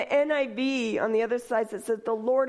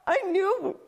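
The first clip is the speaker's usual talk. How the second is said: laughing excitedly; 11 semitones higher than usual. Real, not acted.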